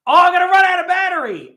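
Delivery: loud, fast, high alarm; exasperated outburst. Real, not acted. Vlogger sees camera battery dying, loud alarmed outburst. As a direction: blurt it out in panic.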